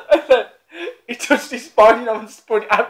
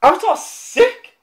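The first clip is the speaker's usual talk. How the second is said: Avatar's sick, rising high and slow with delight; excited; 12 semitones higher, slower than usual. Real, not acted. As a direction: gush about it, pitch climbing. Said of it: "I heard it was wank".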